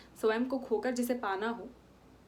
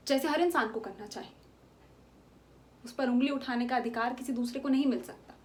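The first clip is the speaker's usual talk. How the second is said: ordinary talk, nothing marked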